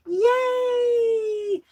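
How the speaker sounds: high squealing yay; delighted shout of yay; excited cheer. Real, not acted. Cheering at a bird feeder.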